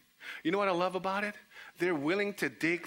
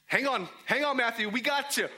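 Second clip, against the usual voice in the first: higher-pitched, urgent call; 6 semitones higher than usual. Acted. Friends lowering Matthew through the roof, urgent calling. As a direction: call down to steady your friend.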